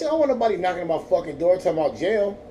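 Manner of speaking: ordinary talk, nothing marked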